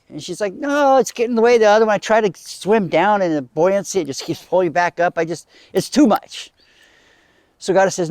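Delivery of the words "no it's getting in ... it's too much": higher-pitched acted-out grumbling; mildly hammed-up frustration; exasperated complaint. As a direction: complain as if fed up with the nuisance. Lilith complains to Goddess.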